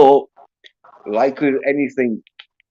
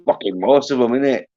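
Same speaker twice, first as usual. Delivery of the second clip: normal talking voice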